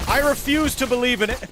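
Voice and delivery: high, loud outburst of disbelief. Real, not acted. Gamer protesting a surprise attack, loud disbelief. Under sudden laser fire in game.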